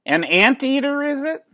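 very high, doubtful question; suspicious neighbor lady. A narrator's reading. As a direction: peer at it with distrust.

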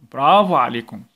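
usual speaking voice

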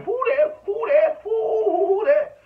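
high, loud mocking chant of Saints fans. Mocking New Orleans fans, shouted high and loud. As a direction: yell it like a gloating fan, way up high. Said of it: "y'all probably sitting up there".

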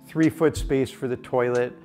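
normal talking voice

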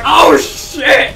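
loud, high mock shout; hammed-up cry of alarm; imitated alarmed yell. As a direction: yell it as the startled player. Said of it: "He came in and was like".

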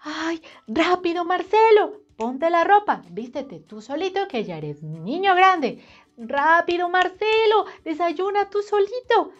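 a frazzled mom hustling her son; hurried mom. A narrator's reading. Mom rushes Marcelo to dress and eat, high and frantic.

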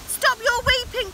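high, urgent knightly call; heroic cry. A narrator's reading. Knight bounding over to rescue the princess, crying out. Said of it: "cried the knight".

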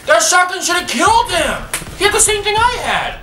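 high indignant shout; outraged yell. Real, not acted. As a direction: yell it in disbelief. Outraged at losing a fight.

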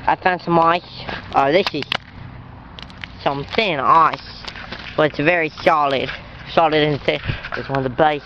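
casual mock Australian accent; put-on Australian accent. Vlogger showing viewers the ice, mock Australian accent. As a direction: play a chatty Aussie outdoorsman.

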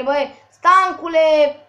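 ordinary delivery

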